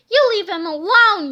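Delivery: loud, defiant shout; protective yell. Acted. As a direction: stand up to the bully. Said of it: "yelled George".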